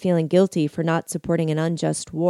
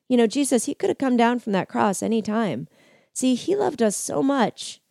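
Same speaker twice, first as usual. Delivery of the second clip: voice cracking; 6 semitones higher than usual. A narrator's reading. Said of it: "his voice cracking".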